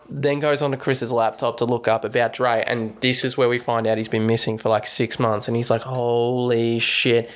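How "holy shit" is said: very slow, drawn-out astonishment; mock stunned realisation. Retelling Rod finding the man is missing, slowly stunned. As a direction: stretch both words out in amazement. Retelling Rod's shocked discovery.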